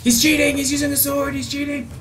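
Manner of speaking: high indignant cry of cheating; outraged cry. Real, not acted. Enemy with sword, outraged.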